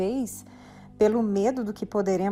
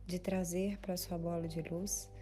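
guided meditation; 6 dB quieter than usual. Real, not acted. Meditation instructor guiding listeners to breathe and release fears, unhurried guided meditation. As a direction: guide the listener gently inward, softer toward the end.

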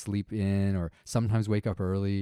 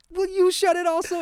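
exasperated dad; 5 dB louder than usual. Retold or imitated. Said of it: "I'm like".

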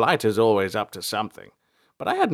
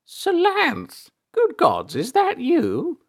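put-on very high, loud old man's exclamation; delighted recognition; 5 dB louder, much slower than usual. A narrator's reading. Elder recognises Salanth once glasses are on, astonished. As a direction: cry out in astonishment, stretching the question.

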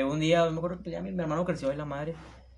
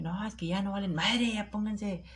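a higher, put-on voice of a scolding dad; mock father scolding; 7 semitones higher, slower than usual. Son imitates his father scolding him and his brother, mock scold. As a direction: throw out the dad's reprimand, lightly imitated.

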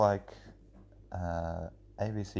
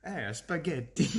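higher, hammed-up Italian accent; over-the-top Italian; 7 semitones higher than usual. Podcaster joining the joke, mock Italian accent. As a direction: play a cartoon Italian. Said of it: "speak Italian for it to be over, overly Italian".

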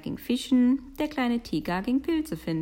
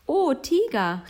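high, loud delighted exclamation; calling out; 8 semitones higher, 8 dB louder, slower than usual. A narrator's reading. Little bear calls tiger on seeing a house, excited exclamation. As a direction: cry out in wonder to a friend. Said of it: "rief der kleine Bär".

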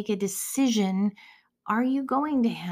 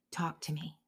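soft, hushed plea; a whispered request for God to speak; whispered prayer; 5 semitones lower, 12 dB quieter than usual. Acted. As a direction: murmur it as a private prayer.

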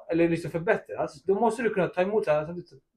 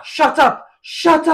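loud and much higher, angry yell; retold shout; 10 semitones higher, 11 dB louder, slower than usual. De Bruyne turning on Pep mid-match: loud angry yell. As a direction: yell it back at the coach who keeps shouting. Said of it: "han bara".

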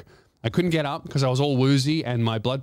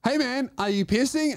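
higher, lightly imitated nurse's question; a hint of the pushy night nurse, pitched up; nurse checking in; 9 semitones higher than usual. Night nurse pressing the patient, lightly imitated higher voice. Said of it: "he goes".

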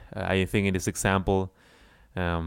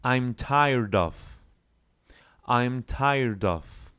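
slow repeat-after-me phrase; slow teacher model; slow drill; much slower than usual. Shown as a demonstration. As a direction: model it slowly to copy. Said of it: "Try to say with me".

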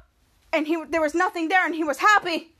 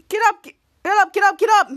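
loud, higher repeated shouts; retold urging; 4 semitones higher, 7 dB louder than usual. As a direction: imitate a spectator yelling at a fighter. Retold urging fallen fighter.

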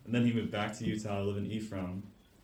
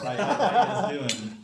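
put-on loud, drawn-out Delaware accent, laughing; hammed-up Delaware local greeting accent; Delaware street accent; 6 semitones higher, 8 dB louder, slower than usual. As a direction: imitate a rough Delaware accent, higher and louder. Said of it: "homeless people say yes".

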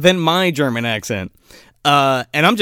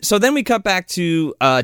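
ordinary talk

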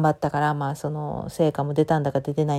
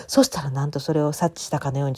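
normal talking voice

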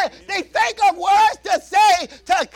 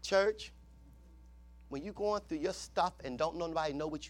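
much softer, lower, intimate preaching; soft exhortation; 10 semitones lower, 15 dB quieter than usual. Acted. Preacher gently urging the church, much softer. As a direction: draw them close in a hush.

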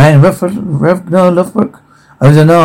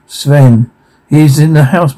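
ordinary delivery

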